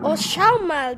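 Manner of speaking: ordinary talk, nothing marked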